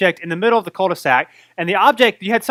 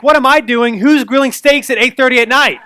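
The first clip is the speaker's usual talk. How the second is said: mock indignant; 5 semitones higher, 7 dB louder than usual. Kid's joking comeback.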